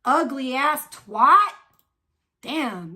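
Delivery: ordinary talk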